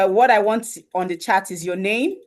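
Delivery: ordinary delivery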